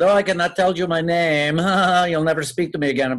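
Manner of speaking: hammed-up Puerto Rican accent, dodging the question; badly disguised caller with a Puerto Rican accent; terrible Puerto Rican accent. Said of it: "it was a terrible accent".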